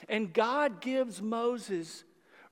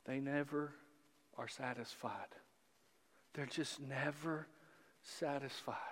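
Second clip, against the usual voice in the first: much softer, lower weary mutter; preacher's soft resigned aside about the people; quiet lament; 7 semitones lower, 15 dB quieter than usual. Acted. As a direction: sigh it out under your breath.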